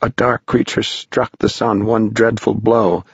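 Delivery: plain reading voice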